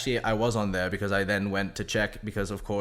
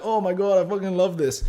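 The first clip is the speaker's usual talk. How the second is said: mock fan gushing; 7 semitones higher, 5 dB louder than usual. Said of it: "They're like".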